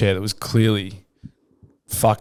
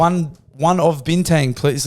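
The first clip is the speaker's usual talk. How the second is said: slow, higher-pitched broken English; mock broken English; 4 semitones higher, slower than usual. Ordering a beer in Bali, mock broken English. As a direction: imitate someone putting on broken English. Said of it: "start speaking broken English".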